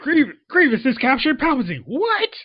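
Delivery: high-pitched disbelief, leaping up on WHAT; shocked outburst. Acted. Fan reacting to Palpatine's capture, shocked. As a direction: react in loud, high astonishment.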